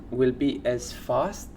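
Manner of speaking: normal talking voice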